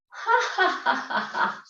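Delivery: deliberate fake "ha, ha" laugh, dropping to breathy; fake laugh demo. Shown as a demonstration. Leader demos a fake laugh for the class, deliberate. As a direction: show them a forced laugh to copy. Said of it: "Even if it's fake".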